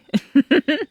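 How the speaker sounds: high, loud delighted giggle; creepy giggle. Acted. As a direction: giggle like the fear delights you. Laughs at captive's fear.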